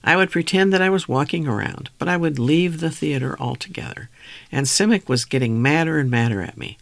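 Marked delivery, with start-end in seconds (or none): none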